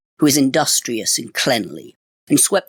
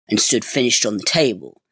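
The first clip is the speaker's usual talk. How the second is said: plain reading voice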